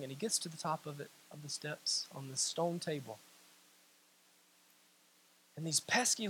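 low, very soft sermon voice; hushed storytelling. Real, not acted. Preacher telling of the lion being bound, hushed and low. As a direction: let the story sink to a hush.

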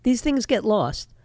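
ordinary talk

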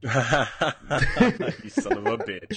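laughing hard through a mock insult; cracking up while calling him a son of a bitch. Real, not acted. Host laughing at his cohost's boast, laughing insult.

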